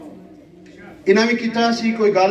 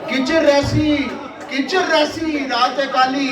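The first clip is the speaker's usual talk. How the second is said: slow, ringing declamation of a refrain; poetry recitation; 4 semitones higher, slower than usual. Acted. Poet putting a refrain to the audience, declaimed. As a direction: stretch the verse out for the hall.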